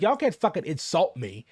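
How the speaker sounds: ordinary talk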